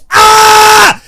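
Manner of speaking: long, high, full scream; pained scream. Retold or imitated. Comic retelling of the painful test, pained scream. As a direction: scream out the pain at length.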